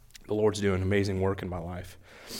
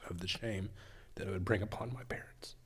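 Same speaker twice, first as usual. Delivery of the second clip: words catching in the throat; barely holding back tears; choking up; 8 dB quieter than usual. Real, not acted. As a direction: voice fading to a tearful whisper. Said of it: "Sorry, guys".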